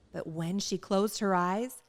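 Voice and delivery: ordinary delivery, nothing marked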